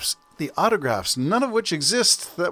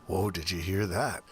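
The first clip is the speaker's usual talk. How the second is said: ordinary talk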